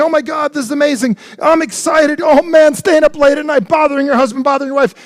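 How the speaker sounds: hammed-up childlike glee, climbing in pitch; excited little kid. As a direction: play a five-year-old bursting with excitement. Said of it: "it's like".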